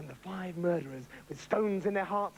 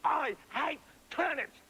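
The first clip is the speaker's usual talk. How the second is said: temper tantrum; 8 semitones higher, slower than usual. Acted. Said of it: "in a temper tantrum".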